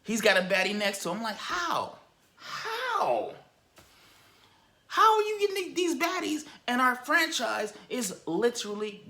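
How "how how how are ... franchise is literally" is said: high, strained squeals of disbelief; a fan squealing at the owner in disbelief; exasperated disbelief. Retold or imitated. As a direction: pitch it way up in outraged disbelief.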